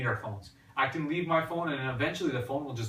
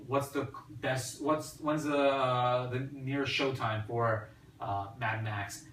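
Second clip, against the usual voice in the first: slow, deliberate query to a watch; slow voice command; slower than usual. Retold or imitated. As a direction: spell it out slowly for the device. Asking the watch a question.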